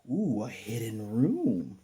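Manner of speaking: slow, drawn-out curious wonder; intrigued ooh. Retold or imitated. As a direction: savor the discovery slowly. Finds hidden room.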